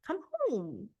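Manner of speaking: small, high, soft plea; drawn-out lovesick begging; pleading. Acted. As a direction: whine it softly to someone far away. Acted plea to absent boyfriend.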